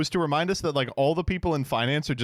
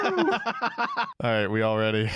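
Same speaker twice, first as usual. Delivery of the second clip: put-on voice; 2 semitones lower, much slower than usual. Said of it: "I'm like".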